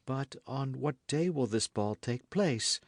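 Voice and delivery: usual speaking voice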